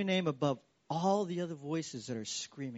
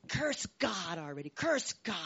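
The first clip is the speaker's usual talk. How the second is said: mock nagging wife; 5 semitones higher than usual. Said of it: "be like Job's wife".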